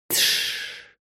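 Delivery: soft unvoiced hush; whispered hush. A narrator's reading. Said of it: "прошептал Андрей ей на ухо".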